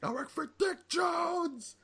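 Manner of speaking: high, slow, shouted whiny villain voice; mock villain plea. Retelling Boddicker begging RoboCop, slow high mock shout. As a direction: squeal it like a panicked crook naming his boss. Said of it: "like, shit".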